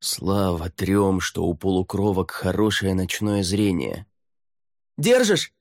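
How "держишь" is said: high, urgent shouted question. A narrator's reading. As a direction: call up sharply from below. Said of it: "крикнула Фэй".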